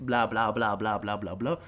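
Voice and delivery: low, monotone blah-blah; mocking drone. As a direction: imitate someone droning through a script. Mocks other toppers' scripted text.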